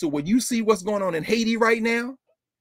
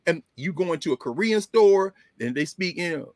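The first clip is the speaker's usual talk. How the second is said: ordinary delivery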